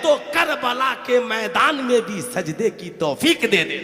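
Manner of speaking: loud sermon building to a high shout; forceful, swelling preacher's cadence; impassioned preaching. Real, not acted. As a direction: drive each warning home, rising to a climax.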